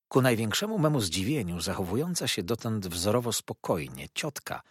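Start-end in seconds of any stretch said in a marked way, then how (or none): none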